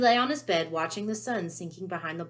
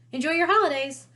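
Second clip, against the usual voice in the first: higher, louder mock-cheery sign-off; bright; 10 semitones higher, 8 dB louder than usual. The Ministry letter ends with a falsely cheery farewell. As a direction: sing out the cheerful closing line.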